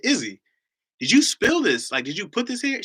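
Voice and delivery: high, loud retold dad interrogation; incredulous questioning. Dad asks Izzy who spilled this, incredulous. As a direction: press a small child for the truth, playfully acted.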